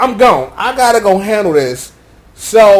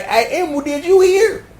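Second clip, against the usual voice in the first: put-on a bit higher gossipy girl; lightly voiced Poppy; 8 semitones higher than usual. Poppy telling Emerald gossip, slightly higher. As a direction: chirp the news. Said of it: "Is that Poppy like".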